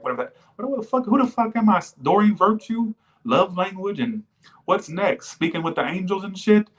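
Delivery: high-pitched, baffled outburst at herself; incredulous self-mockery. Real, not acted. Reader mocks her own love-language talk, incredulous. Mocking own channeled words.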